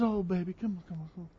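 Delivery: mock-tender, very soft coaxing; sweetheart coaxing. Preacher mocks what Jesus would not say, sweetheart coaxing. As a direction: coo it very quietly, as if babying someone. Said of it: "He's not a sweetheart".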